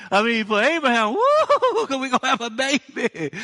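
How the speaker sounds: high, loud laughing whoop; a laughing whoop of amazement; laughing exclaim. Real, not acted. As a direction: laugh through the joyful shout. Preacher laughing at it.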